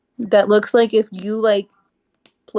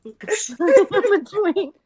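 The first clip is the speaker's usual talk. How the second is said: laughing; 10 semitones higher, much slower than usual. Acted. Quoting the line while laughing.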